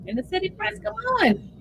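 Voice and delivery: high-pitched impatient urging; impatient pleading. Real, not acted. Viewer begs Inner City Press to post an update, impatiently.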